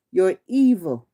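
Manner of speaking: ordinary talk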